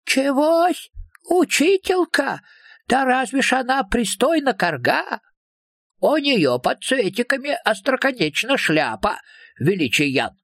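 high little creature, somewhat disapproving; mildly disapproving creature. A narrator's reading. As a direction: object in a high, folksy character voice. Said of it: "несколько неодобрительно ответил второй голос". (слегка неодобрительный высокий голосок существа)

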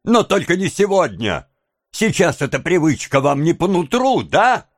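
high, heated reproach; embittered high-pitched taunting; bitter. A narrator's reading. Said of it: "с горечью воскликнула девушка".

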